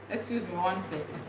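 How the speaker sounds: usual speaking voice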